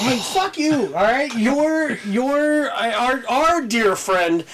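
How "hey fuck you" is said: mock offended. Real, not acted. Joking retort to teasing.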